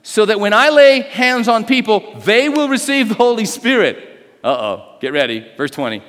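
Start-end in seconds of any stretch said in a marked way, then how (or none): 0.0-4.0 exclaimed request. Acted. Simon asking Peter for the power, eager. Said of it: "he exclaimed".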